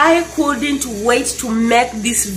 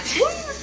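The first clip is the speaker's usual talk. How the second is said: short high laugh; 7 dB quieter than usual. Real, not acted. Laughing at her own story.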